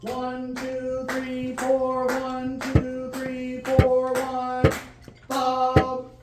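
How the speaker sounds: loud, high count-in for the band. Real, not acted. Band director counting the players in loudly. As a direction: call the count so the whole band hears. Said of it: "counting the band in".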